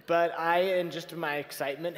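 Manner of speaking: ordinary talk, nothing marked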